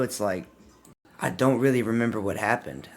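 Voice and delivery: ordinary talk, nothing marked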